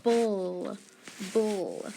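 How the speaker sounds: bull drawn out, soft, then repeated after a pause; bull, said alone for dictation; isolated syllable sound. Shown as a demonstration. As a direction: give bull as a sound to spell. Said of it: "The first sound that we're gonna write is".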